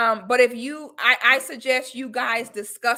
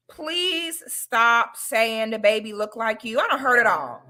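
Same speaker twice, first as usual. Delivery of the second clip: ordinary delivery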